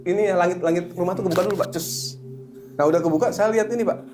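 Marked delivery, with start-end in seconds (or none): 1.7-2.2 quick soft "chus" sound effect; hissed burst for a sudden opening; whooshing hiss. Retold or imitated. Storyteller imitates the house ceiling opening, soft hiss.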